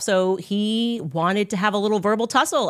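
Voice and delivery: normal talking voice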